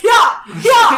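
triumphant yell. Acted. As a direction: whoop it out as you ride in. Bursting out on horse, cheering.

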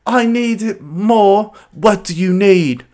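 high, drawn-out British voice; slow, loud mock-British guy, pitched well up; British accent. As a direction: stretch it out in a put-on British accent. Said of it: "a British guy being like".

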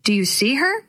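usual speaking voice

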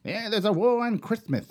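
high mocking impression of conservatives; mock them in a high whiny voice. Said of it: "are going".

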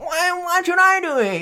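high, slow sniveling whine; snivelly whine. Retold or imitated. Co-host mocks a sniveling Damon, high whine. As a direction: whimper it like a spoiled brat. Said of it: "a snivelly little bitch".

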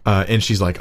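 ordinary talk

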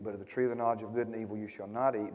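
a soft, rushed recital; hushed quick quote. Shown as a demonstration. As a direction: tick the verse off quickly under your breath. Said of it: "that's not how he said it".

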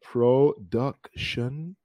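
"Production" split slowly as pro, duc, shun with pauses; very slow, teacherly "Pro... duc... tion"; syllable by syllable. Shown as a demonstration. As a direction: model "Production" in three separated beats. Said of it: "three syllables here we have".

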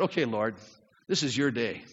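low, soft morning prayer; humble surrender. Acted. Believer getting up and talking to the Lord, quietly yielding. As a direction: hand the day over quietly.

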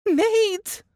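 a high, loud call of his name. A narrator's reading. Laura calling for her husband from the couch, loud and high. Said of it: "Laura called out his name".

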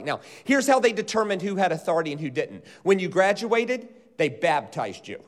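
ordinary delivery

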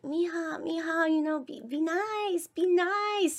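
high-pitched pleading papa voice; imitated papa. Papa urging the child to be nice, imitated pleading.

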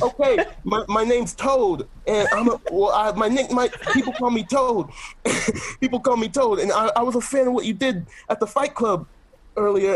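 timid-fan voice with a hint of Italian accent; Toad. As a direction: stumble through a fan's confession, lightly Italian-accented. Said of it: "too deep into the Italian accent".

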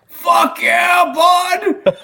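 high whooping shout out the window; mock yell. [name] cheers his cousin from car, whooping shout.

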